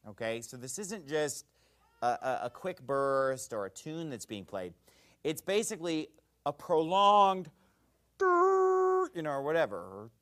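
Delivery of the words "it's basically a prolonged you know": a long high held note like a trumpet; imitated long trumpet blast. Shown as a demonstration. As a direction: hold one high note like a horn. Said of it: "I'm not making the right sound".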